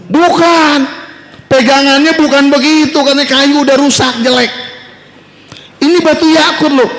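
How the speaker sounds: high, earnest voice of a humble woodcutter; humble woodcutter politely refusing; honest woodcutter. Acted. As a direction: refuse the gold axe, pitched high and sincere.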